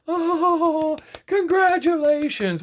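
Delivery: high, sing-song mock congratulations; mock cheering. Mocking the women who cheer the winner, sarcastic gush. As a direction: sarcastically gush like a fawning fan.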